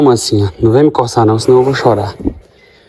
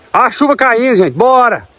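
much higher, urgent cry as the rain starts; excited call; 11 semitones higher than usual. Real, not acted. Farmer hurrying the herd as rain falls, excited. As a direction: hurry everyone along.

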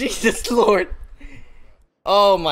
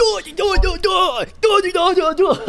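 mocking imitation; 11 semitones higher than usual. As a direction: mimic a friend as mindless high-pitched noise. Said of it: "That's what you sound like".

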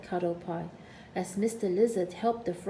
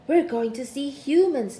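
proud and loud; boasting; 4 semitones higher, 8 dB louder than usual. A narrator's reading. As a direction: boast it to the lizard. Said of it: "boasted Snugglepot".

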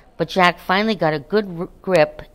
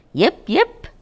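high, sharp imitated puppy yip; a tiny dog yipping from the grass; 7 semitones higher, 4 dB louder than usual. Narrator voicing a hidden puppy, high yip.

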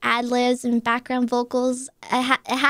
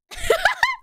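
high giggle. Real, not acted. Giggle after e-girl voice.

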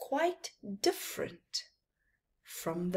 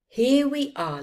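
merchant; 7 semitones higher, 8 dB louder than usual. A narrator's reading. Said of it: "said the merchant".

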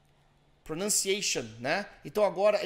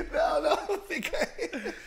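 laughing; 12 semitones higher, slower than usual. Real, not acted. Student jokes he gives up.

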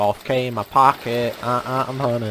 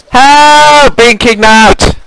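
loud, high mock panic yelling; shouting for help; 10 semitones higher, 17 dB louder, much slower than usual. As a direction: yell for help, hammed-up. Mock kidnapping cry for help.

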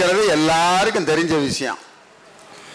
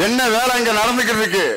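ordinary talk, nothing marked

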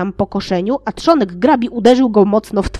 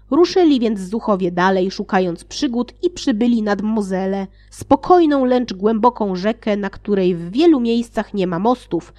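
ordinary delivery

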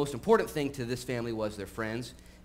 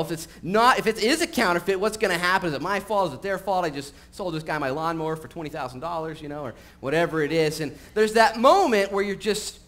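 louder, higher animated storytelling; energetic preaching; 6 semitones higher, 8 dB louder than usual. Acted. Energetic sermon illustration.

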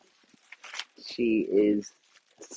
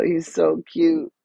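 cooing; 8 semitones higher, 4 dB louder, slower than usual. Real, not acted. Cooing over the troll.

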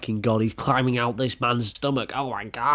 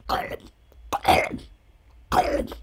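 throaty Gollum swallow; Gollum gulp. Acted. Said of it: "That was a good impre".

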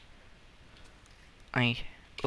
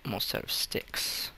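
whisper to self; 3 semitones lower, 6 dB quieter than usual. Real, not acted. Muttering to self.

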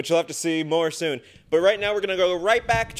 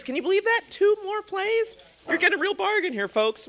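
much higher hammed-up salesman voice; mock huckster selling two more plays; infomercial pitch; 14 semitones higher than usual. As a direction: sell it like a TV pitchman. Host hamming up a sales pitch.

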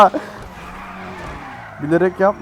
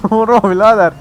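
usual speaking voice

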